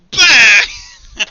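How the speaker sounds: shouted bang; loud gunshot noise. Acted. Game master voices Isaac's gunshot as a loud shouted bang. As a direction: blast it out like a pistol shot.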